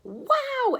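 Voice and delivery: high, bright-eyed wow; excited exclaim. A narrator's reading. Boy sees a rabbit dart past, exclaims in wonder. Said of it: "Alan exclaimed".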